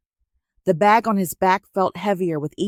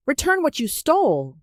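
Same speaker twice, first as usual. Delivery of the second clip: much higher shouted command; shouted demand; 8 semitones higher than usual. A narrator's reading. Masquerades ordering Nonso to return it, shouting. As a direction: bark the demand down at him. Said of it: "they shouted".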